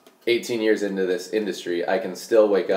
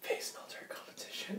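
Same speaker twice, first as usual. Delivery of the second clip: breathy conspiratorial whisper; 20 dB quieter than usual. Pretend. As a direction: hush it like a secret. Playful secret whisper.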